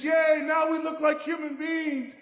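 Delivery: high, loud triumphant yay; cheering shout. Acted. Puppy cheering that they look human, loud high shout. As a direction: cheer it out to the pack.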